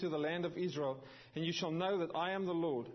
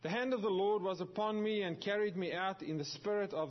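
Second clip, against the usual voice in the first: scripture reading; 4 semitones higher than usual. Acted. Pastor reads Ezekiel thirty-seven to the church, proclaiming it. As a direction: proclaim the verse to the congregation.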